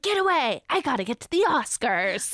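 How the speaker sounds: high, loud actress impression easing down; dramatic starlet shooing someone away loudly; Jennifer Tilly. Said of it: "this is my Jennifer Tilly impression".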